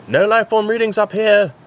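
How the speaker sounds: loud, high shout. A narrator's reading. Doctor shouting while scanning the house. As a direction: call it out across the house. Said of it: "the Time Lord shouted".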